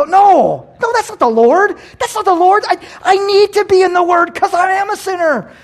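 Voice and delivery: high, full-volume protest; defiant shout. Acted. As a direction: shout the accuser down. Believer shouts back at the accuser.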